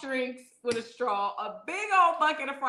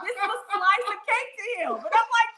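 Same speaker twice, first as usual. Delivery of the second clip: very high, squealing through laughter; hysterical laughing; 13 semitones higher than usual. Real, not acted. Wife telling viewers about his cake slices, laughing hysterically. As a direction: protest while losing it laughing. Mocking his portions while cracking up.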